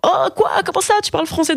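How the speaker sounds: high-pitched mock astonishment; hyper étonnée. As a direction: act out stunned disbelief, voice jumping way up. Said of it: "j'étais hyper étonnée". (surprise rejouée, voix haut perchée)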